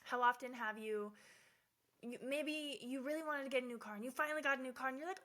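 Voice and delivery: ordinary talk, nothing marked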